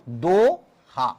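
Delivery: "Doha" very slow, long do, pause, then ha; syllable by syllable. Shown as a demonstration. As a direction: break "Doha" into two drawn-out syllables. Teacher splits the term into syllables. ("दोहा" को दो... हा तोड़कर, धीरे)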